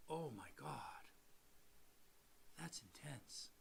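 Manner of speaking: slow, very soft awe; barely voiced, as if it just sank in; hushed, stunned. Lender hit by the client's words, hushed and stunned. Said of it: "And that hit me".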